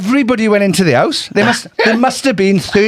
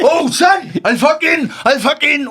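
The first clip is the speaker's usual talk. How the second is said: mock threat; 7 semitones higher, slower than usual. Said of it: "a van goes past and he's like".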